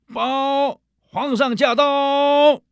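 high, drawn-out herald shout; shrill palace servant. Retold or imitated. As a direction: cry it out like a court herald. Third soldier announces.

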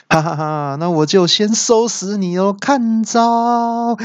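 high, laughing villain attack call; gloating battle cry. A narrator's reading. 恶怪得意出招. (得意大笑，拉高喊招)

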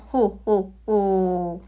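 slow, deep-chested ho ho ho; Santa Claus laugh. Acted. Pleased king laughing at the gift of quails, like Santa Claus. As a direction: chuckle slowly like Santa Claus. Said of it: "这好像圣诞老人的笑声哦".

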